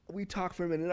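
ordinary talk, nothing marked

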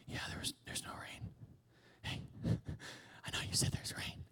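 hushed servant reporting no rain; whispered report. Acted. Servant reporting to Elijah there's no rain, whispered. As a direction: whisper it, embarrassed.

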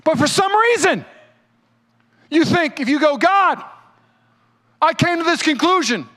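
high, loud, impassioned sermon delivery; fired-up preacher at full volume; full-force preaching. Acted. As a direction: drive every line home to the crowd.

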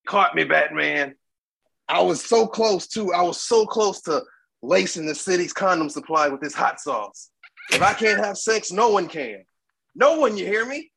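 cackling villain, voice climbing high; Joker voice. Acted. Plays the Joker in skit.

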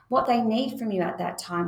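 usual speaking voice

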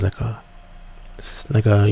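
usual speaking voice

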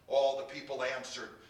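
ordinary delivery, nothing marked

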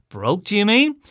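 high, loud incredulous question; loud baffled query; astonished. A narrator's reading. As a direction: demand it in disbelief.

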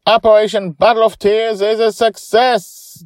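high, loud triumphant whoop. A narrator's reading. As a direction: cheer it out in victory. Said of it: "Joy whoops".

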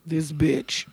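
"This bitch" sussurrato a bassa voce sottovoce; muttered insult. Retold or imitated. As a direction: mormora sottovoce con disprezzo.

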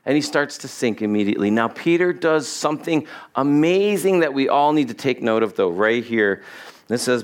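usual speaking voice

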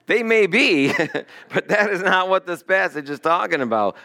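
real laugh breaking through the words; amused, laughing disagreement. Real, not acted. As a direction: chuckle while correcting the point. Laughs at officiant's comparison.

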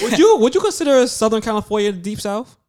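high-pitched incredulous asking; incredulous question. Real, not acted. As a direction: ask it high, as if it's absurd. Puts a sudden question to the group.

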